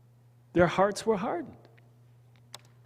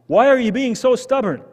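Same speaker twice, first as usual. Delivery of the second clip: scolding parent; 6 semitones higher, 7 dB louder than usual. Retold or imitated.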